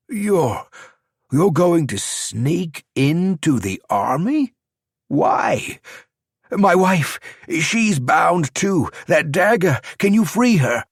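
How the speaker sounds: high, pleading, slow on his wife; desperate. A narrator's reading. Champion begging the stranger to free his wife, desperate. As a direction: beg for help for his bound wife. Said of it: "Desperation seeped into his words".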